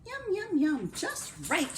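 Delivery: high, sing-song relish; delighted Goldilocks. Acted. Goldilocks trying the little bowl, delighted.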